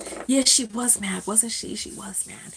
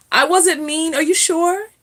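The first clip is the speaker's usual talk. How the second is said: usual speaking voice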